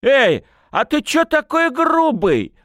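high, loud, drawn-out protest; tipsy, affronted yelp; indignant. A narrator's reading. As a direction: take loud offence at a high pitch. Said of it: "возмутился парень".

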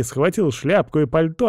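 plain reading voice

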